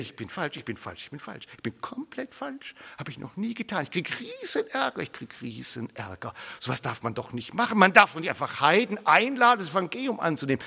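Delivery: panicked inner voice. Acted. Peter baptizing gentiles and fearing trouble, anxious and hushed. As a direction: fret under your breath. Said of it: "die ganze Zeit denkt er".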